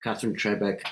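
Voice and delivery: ordinary delivery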